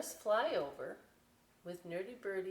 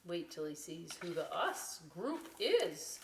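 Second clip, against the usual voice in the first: ordinary delivery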